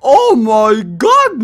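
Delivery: loud, drawn-out exclamation; wowed gamer cry; amazed shout. Real, not acted. Amazed reaction to the game.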